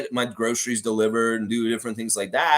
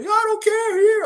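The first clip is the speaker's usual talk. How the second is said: very high, loud caricature; imitated brash landlord; 7 dB louder than usual. Landlord insisting on hug despite covid, high loud imitation. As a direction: brush it off in a squawky mock voice.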